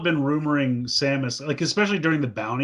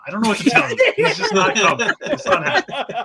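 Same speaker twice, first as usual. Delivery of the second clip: laughing while he talks; words break into laughs; 7 semitones higher, 4 dB louder than usual. Real, not acted. Laughing through his reply.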